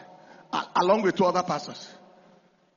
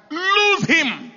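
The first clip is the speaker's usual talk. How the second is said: high, drawn-out order; preacher's commanding cry, pitched up; retold command; 9 semitones higher, 9 dB louder than usual. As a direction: call out a deliverance command. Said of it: "Next command".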